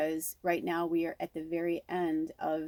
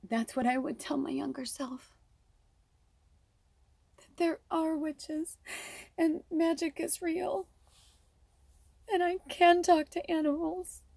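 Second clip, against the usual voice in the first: tearful; 10 semitones higher than usual. Real, not acted. Telling her younger self she is special, crying high. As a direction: push the words out through real tears. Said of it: "I'm wiping my tears".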